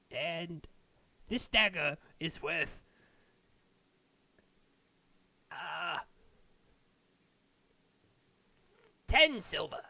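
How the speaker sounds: high, slow merchant's voice, working out the price; haggling dwarven smith. Acted. Dwarf smith quoting a dagger price to adventurers, high and slow. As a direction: bargain carefully, correcting himself.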